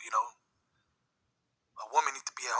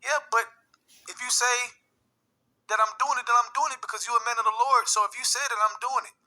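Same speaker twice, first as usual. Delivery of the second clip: mother imitated; 10 semitones higher, 4 dB louder than usual. Mother's game, imitated high.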